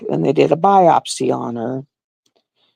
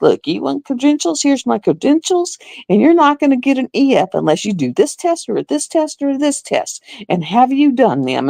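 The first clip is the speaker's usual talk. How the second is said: retold angry confrontation; 8 semitones higher than usual. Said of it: "I was getting louder and louder".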